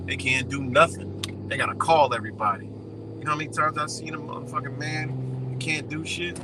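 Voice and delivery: normal talking voice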